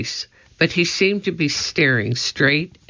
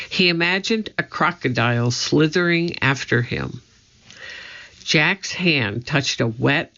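plain reading voice

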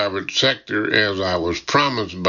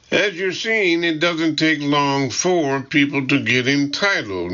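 high-pitched, lightly ironic secretary; pointed remark with a touch of irony, pitched up; 6 semitones higher than usual. A narrator's reading. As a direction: make the dig politely, higher voice, mildly ironic. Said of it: "Maggie says ironically".

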